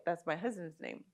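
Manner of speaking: ordinary talk, nothing marked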